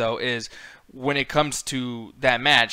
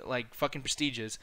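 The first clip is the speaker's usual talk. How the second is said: ordinary talk, nothing marked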